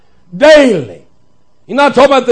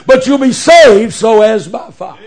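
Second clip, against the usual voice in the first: usual speaking voice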